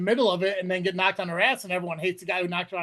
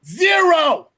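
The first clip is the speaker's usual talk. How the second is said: loud, high punchline; emphatic shout; 7 semitones higher, 8 dB louder than usual. Real, not acted. Host boasting about his track record, loud and high. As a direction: bellow the answer proudly. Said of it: "my track record".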